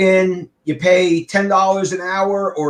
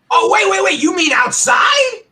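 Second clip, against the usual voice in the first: loud, high-pitched incredulous challenge; retold rising yell; 14 semitones higher, 4 dB louder than usual. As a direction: raise your voice in mock disbelief. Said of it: "And I started yelling".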